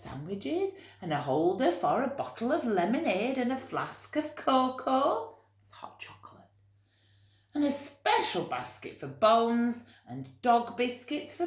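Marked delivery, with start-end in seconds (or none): 5.6-6.5 quick hushed explanation; whispered aside. Real, not acted. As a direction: whisper it as a side note. Aside to children explaining cocoa.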